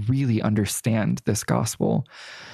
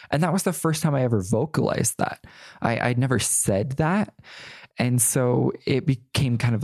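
ordinary delivery

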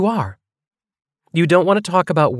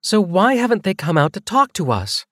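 higher female voice, insistent; raised pitch, pressing the point hard; increasingly forceful; 5 semitones higher than usual. A narrator's reading. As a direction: push back with growing force. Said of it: "Her increasingly forceful tone".

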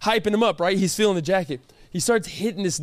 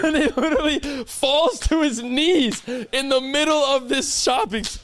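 ordinary talk

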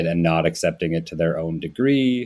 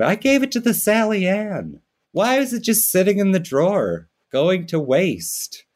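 much higher mother voice, slowing right down at the end; mom's voice; 10 semitones higher than usual. Retold or imitated. Imitating mom explaining the cutlery giveaway, high and slowing. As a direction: innocently justify it like the confused mom.

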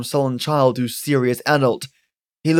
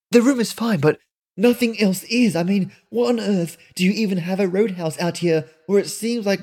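put-on higher, pressing young woman's voice; indignant young woman; 6 semitones higher than usual. Melinda presses Granny about the roadhouse, indignant and higher. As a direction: demand answers, rattled and suspicious.

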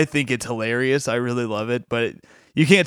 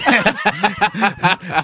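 words giving way to real laughter; laughing; 7 semitones higher, 3 dB louder than usual. Real, not acted. Comic host admitting what made them laugh, laughing. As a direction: break up laughing at the end, slightly higher. Breaks up laughing at own quote.

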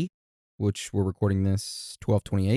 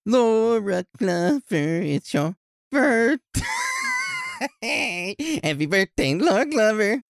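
Sesame Street voice; 11 semitones higher than usual. Acted. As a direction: sing it like a kids' TV puppet. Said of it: "in Sesame Street style".

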